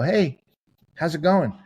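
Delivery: breezy upbeat hallway greeting; cheery greeting. Retold or imitated.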